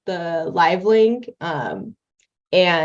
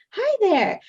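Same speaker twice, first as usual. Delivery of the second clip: high, chirpy cartoon greeting. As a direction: put on a high-pitched cartoon character. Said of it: "I have this cartoon voice".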